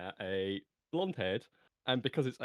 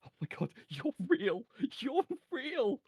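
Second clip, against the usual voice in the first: high-pitched astonishment, climbing on the repeat; amazed, excited; 3 dB louder than usual. Acted. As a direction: exclaim in rising wonder and disbelief. Character finds the people are real.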